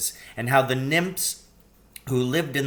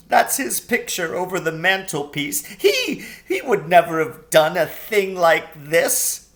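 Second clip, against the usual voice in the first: weeping faun; 5 semitones higher, 5 dB louder than usual. A narrator's reading. Tumnus laughing through tears.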